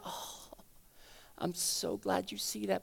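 slow, hushed, tender reassurance; tender relief. Acted. God welcoming a helpless believer's confession, slow tender hush. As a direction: sigh it out softly and slowly.

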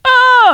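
high, loud comic scream; piercing mock "ah"; same scream in Spanish. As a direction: demonstrate the scream at full volume. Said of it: "that's the same thing in Spanish".

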